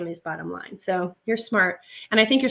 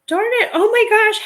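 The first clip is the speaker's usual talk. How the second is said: much higher and louder delighted squeal; excited squeal; 13 semitones higher, 9 dB louder than usual. Real, not acted. Seller spotting a cute owl necklace, squealing.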